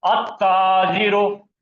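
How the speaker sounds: अत्ताजीरो stretched slowly, double त्त clear; slow Arabic reading. Shown as a demonstration. Teacher to students, modelling the sun-letter reading slowly. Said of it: "क्या पढ़ा जाएगा देखिए".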